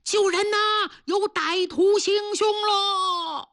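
high, drawn-out storyteller's cry; calling for help. A narrator's reading. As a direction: call out the alarm, stretching the last words. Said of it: "他边跑边喊".

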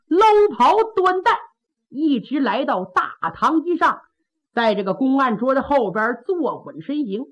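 ordinary delivery

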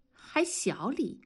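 high-pitched mocking echo, then disgust; cold sneer. A narrator's reading. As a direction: throw the words back with scorn. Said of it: "文丽冷笑着说".

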